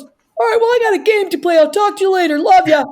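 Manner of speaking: high, loud, hollered sign-off; exuberant dad. Retold or imitated. Father ending call with daughter for his game, loud exuberant. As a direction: shout a cheery goodbye, bursting with energy.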